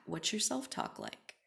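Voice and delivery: ordinary talk, nothing marked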